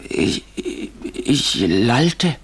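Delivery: very slow, stumbling start; halting. Acted. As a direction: struggle to get each word out. Formerly mute narrator recalls babbling.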